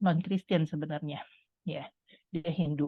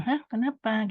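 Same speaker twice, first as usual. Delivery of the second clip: higher-pitched startled question; surprised; 6 semitones higher, slower than usual. Retold or imitated. Salon worker asks customer why she wants her head shaved, surprised. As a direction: retell a taken-aback reaction.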